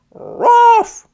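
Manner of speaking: high, loud yappy puppy bark. A narrator's reading. Narrator barks as the puppy facing the kitten. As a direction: bark sharply like a small puppy. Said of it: "said Barkus more bravely".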